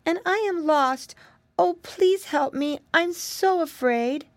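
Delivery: put-on high, slow, pleading little girl; gentle crying voice. A narrator's reading. Lost girl begs piggy boy for help, gently crying. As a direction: plead gently, frightened and tearful. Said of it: "gentle little crying voice".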